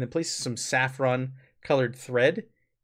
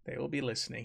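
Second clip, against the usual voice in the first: hushed repeat; 12 dB quieter, faster than usual. Acted. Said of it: "He just repeats".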